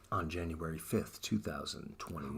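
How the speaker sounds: low, soft mock radio announcer. Podcast host announcing the new season's date, mock radio voice. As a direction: drop it down into a put-on radio voice. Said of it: "my radio voice".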